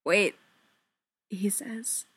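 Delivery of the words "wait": faint croak of a word. A narrator's reading. Fili tries to stop Keely leaving, croaking. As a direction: croak it out, barely managing the word. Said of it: "more of a croak than a word".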